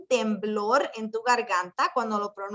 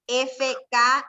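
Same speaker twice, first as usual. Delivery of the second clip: ordinary delivery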